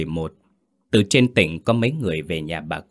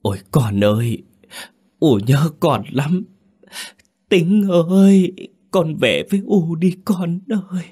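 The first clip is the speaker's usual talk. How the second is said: choked wailing; 10 semitones higher, 4 dB louder than usual. Acted. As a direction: build from a choked cry to a keening plea. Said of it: "Giọng bà lạc đi, nghẹn lại".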